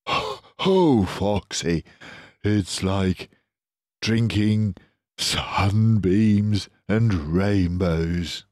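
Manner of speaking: slow, dreamy marvelling; awed Badger. Badger tells Foxy the cider is like sunbeams. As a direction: drift into wonder.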